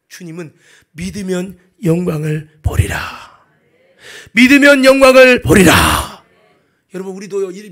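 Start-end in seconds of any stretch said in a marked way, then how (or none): 0.9-3.0 solemn declaration. Acted. Jesus promises glory to believers, solemn declaration. Jesus' word, first measured take. 4.3-6.3 slow, booming repeat at full force; shouted proclamation. Acted. Preacher proclaims Jesus' promise to congregation, shouted proclamation.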